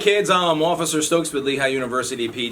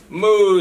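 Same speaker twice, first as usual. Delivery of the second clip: a loud, higher moo for the cow; loud cartoon moo for kids; 6 semitones higher, 6 dB louder than usual. Cow answers duck in story.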